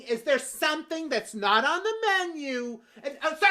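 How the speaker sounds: ordinary delivery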